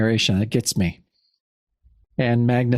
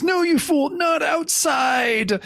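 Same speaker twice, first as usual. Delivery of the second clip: high-pitched dramatic yell, drawn out at the end; high, stretched-out wail of protest; desperate villain's cry; 13 semitones higher, slower than usual. Retold or imitated. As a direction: cry out as a villain thrown from a window. Villain thrown out of castle.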